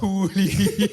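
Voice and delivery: breaking into high laughter; laughing. Real, not acted. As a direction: laugh mid-word. Cracking up at two liters.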